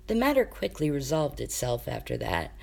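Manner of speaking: plain reading voice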